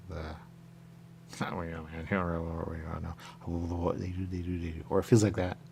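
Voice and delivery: usual speaking voice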